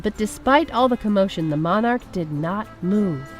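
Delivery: ordinary delivery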